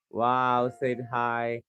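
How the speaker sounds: usual speaking voice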